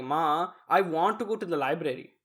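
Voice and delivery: deliberate Desi-accented English; English line in a careful Urdu accent. Shown as a demonstration. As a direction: put an Urdu accent on "library". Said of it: "That would be correct".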